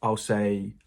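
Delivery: ordinary delivery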